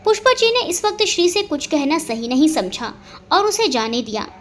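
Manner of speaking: plain reading voice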